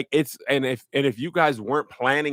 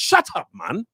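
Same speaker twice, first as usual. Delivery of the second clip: practised British street accent, pitched up; British slang accent; slower than usual. Shown as a demonstration. Showing friends his practised phrase, British accent. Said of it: "The one I really been practicing".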